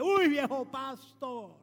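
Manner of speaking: loud, drawn-out street greeting; street windscreen cleaner. Retold or imitated. Windscreen washer spotting the pastor at the traffic lights, drawn-out hail. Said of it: "de los que limpia vidrio".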